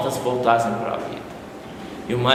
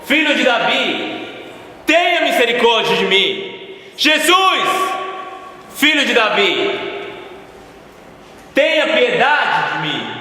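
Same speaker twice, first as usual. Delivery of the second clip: desperate shout; 8 semitones higher than usual. Acted. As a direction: scream it across the crowd, begging. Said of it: "eu comecei a gritar".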